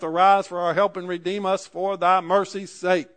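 loud, ringing scripture read from the pulpit; fervent sermon delivery; preaching at full force. Acted. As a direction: proclaim it to the whole congregation. Preacher reading scripture aloud.

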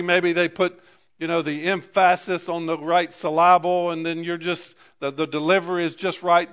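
rising pulpit fervour; preaching. Acted. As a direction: preach it out to the congregation. Preaching builds up.

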